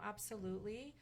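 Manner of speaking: ordinary delivery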